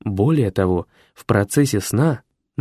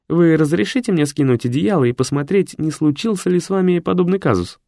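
Boris, higher throughout; lightly voiced prim secretary; 7 semitones higher than usual. A narrator's reading. Secretary explains to his master in his own higher voice.

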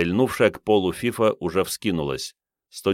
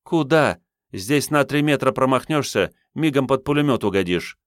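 higher, urgent woman's voice; female character voice; 7 semitones higher than usual. A narrator's reading. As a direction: warn him sharply.

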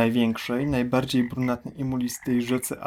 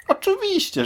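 high mocking; 3 dB louder than usual. Mocking the big crocodile.

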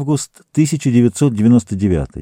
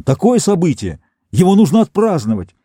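eager exclaim; 7 semitones higher than usual. Acted. Captain urges celebration.

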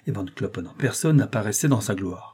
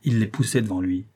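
usual speaking voice

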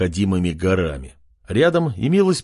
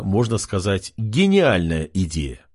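plain reading voice

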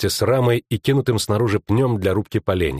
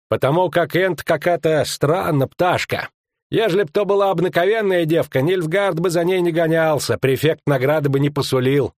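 higher-pitched rustic henchman; folksy, raised-pitch character voice; rustic tracker; 7 semitones higher than usual. Acted. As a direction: explain it in a high country voice.

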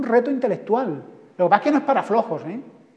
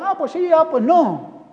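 high, dithering passerby voice; a clueless man on the street who can't decide; 8 semitones higher, 4 dB louder, slower than usual. Retold or imitated. A passerby stammering through a street poll, in a dithering high voice.

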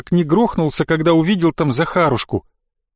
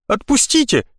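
struggling plea; 7 semitones higher than usual. Acted. Boy struggling against grip.

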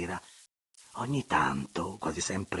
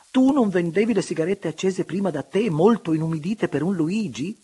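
higher, louder mocking question; laughing tease; 10 semitones higher, 8 dB louder than usual. A narrator's reading. Francesca teasing the baroness, still laughing, high and loud. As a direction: needle her friend while laughing. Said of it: "sempre ridendo".

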